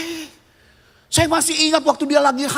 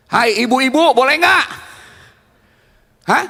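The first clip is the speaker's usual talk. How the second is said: raised-voice question, demanding a reply; calling out. Real, not acted. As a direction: call out to get an answer from the crowd. Calls again to unresponsive audience.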